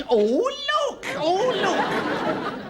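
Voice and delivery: high thrilled cry; excited. Acted. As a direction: squeal with delight at the find. Spots something in the house.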